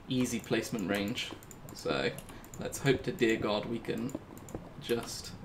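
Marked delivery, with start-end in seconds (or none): none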